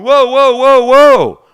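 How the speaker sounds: loud high whoa whoa; alarmed shouts. Retold or imitated. Radio crew shouting to stop the swear.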